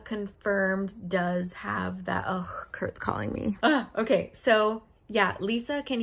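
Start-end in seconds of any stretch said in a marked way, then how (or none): 2.2-3.6 whispered aside. Real, not acted. Aside about incoming phone call.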